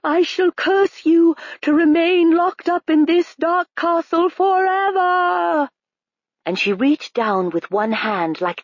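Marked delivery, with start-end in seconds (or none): 0.0-5.7 high, louder, slowing on the last words; high menacing curse that drags out at the end; evil queen's curse. A narrator's reading. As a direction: lay the curse slowly and grandly. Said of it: "the queen continued".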